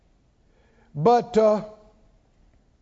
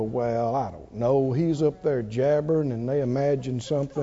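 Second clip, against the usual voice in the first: low, dismissive doubter voice, much lower; mock skeptic; 8 semitones lower, 3 dB quieter than usual. Mocking people who won't accept the miracle, dismissive low voice. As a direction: mutter it like an unconvinced scoffer, lower and softer. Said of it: "They'd go".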